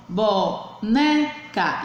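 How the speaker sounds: "boneca" slowly split, leaning on NE; stress on penultimate syllable. Shown as a demonstration. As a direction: sound out "boneca" as bo... NE... ca. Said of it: "a penúltima sílaba for mais forte". ("boneca" devagar, com força no NE)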